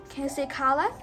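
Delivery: plain reading voice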